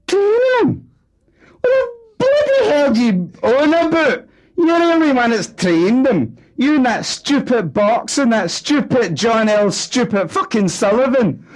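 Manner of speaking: very high, loud, shrill harangue; wife screaming at husband in row; angry shouting. Acted. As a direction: yell him down with scorn.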